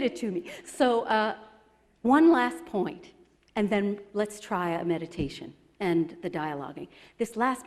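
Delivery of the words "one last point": usual speaking voice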